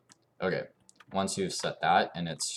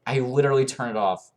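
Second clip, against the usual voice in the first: loud and higher, disbelieving; louder and higher in disbelief; exasperated; 8 semitones higher, 10 dB louder, faster than usual. Real, not acted. Game does something unexpected.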